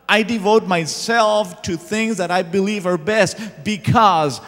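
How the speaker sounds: loud, high impassioned preaching; preacher building to a loud climax; preaching at full force. Acted. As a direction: drive it home with fervor. Preaching climax, rising intensity.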